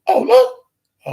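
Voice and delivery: sudden high squeal of recognition; mock shocked "Oh, Lou"; surprised. Surprised recognition in retold scene.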